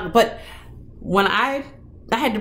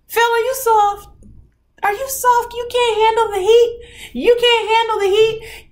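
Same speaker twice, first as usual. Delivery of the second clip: higher teasing jabs, repeated; playful taunt; 13 semitones higher than usual. Real, not acted. Cook teasing Phil for not handling spicy food, higher and playful. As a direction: needle him like a cook daring a friend. Teasing viewer about spice.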